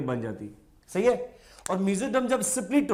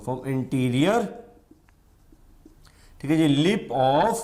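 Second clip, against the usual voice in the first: slow dictation; 2 semitones lower, much slower than usual. Shown as a demonstration. Dictating notes to students.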